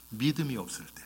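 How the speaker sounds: said softly, trailing down; quiet statement. Shown as a demonstration. Preacher names the one reason for rebuke, quietly. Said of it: "딱 하나".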